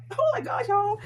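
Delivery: real delight showing the tag; excited squeal. Real, not acted. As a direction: gush at the viewers. Thrilled unboxing dream bag.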